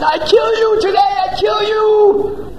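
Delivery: very high, slow shrieked threat; shrieking goddess. Acted. Goddess Chandi screaming at the dacoit leader, shrieking wrath. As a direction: scream the death sentence.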